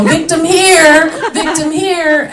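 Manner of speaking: slow, high mock yell. Comedian mock-yelling in Camden with purse open. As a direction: call out slowly like bait. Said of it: "and go".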